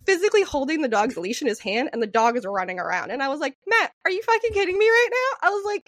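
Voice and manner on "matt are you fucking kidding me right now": high-pitched exasperated disbelief, retold; worked-up, high retold outburst; incredulous retort. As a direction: re-enact telling off a friend in disbelief. Retells scolding Matt in disbelief.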